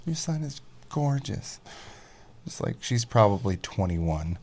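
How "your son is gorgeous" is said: put-on very high gushing girly voice; imitating young woman. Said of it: "She's like".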